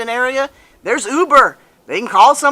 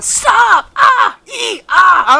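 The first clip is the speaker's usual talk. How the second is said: high, loud panicked yells of stop; replaying the screams at a wrong-way driver; retold panicked screaming; 10 semitones higher, 4 dB louder, much slower than usual. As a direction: scream it like a terrified passenger. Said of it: "we were screaming".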